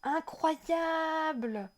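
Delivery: slow, high "Incroyable!" in delight; amazed. Real, not acted. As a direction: draw out the word in wonder. Awe at the game map.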